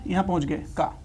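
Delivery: ordinary talk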